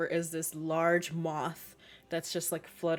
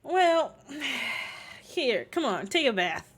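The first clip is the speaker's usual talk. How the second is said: higher, louder Southern voice coaxing a gator; 7 semitones higher, 3 dB louder than usual. Acted. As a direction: coax the gator into the bath, Southern-style. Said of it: "he's just like".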